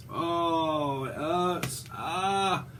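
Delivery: soft, put-on groans of pain; imitating someone in pain, quietly; mock pain groan. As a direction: moan as if something aches, softly. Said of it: "Is someone in pain here?".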